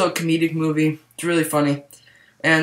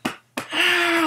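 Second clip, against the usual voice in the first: mock pained cry; 13 semitones higher than usual. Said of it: "He's like".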